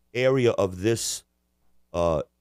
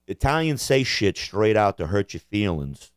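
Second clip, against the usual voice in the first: ordinary delivery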